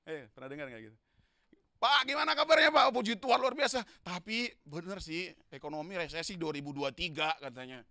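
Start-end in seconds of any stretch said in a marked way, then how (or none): 1.8-7.8 loud, high acted exchange; preaching at full force. Preacher acting out a gloomy churchgoer, loud and high. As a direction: bring the grumbling talk to life.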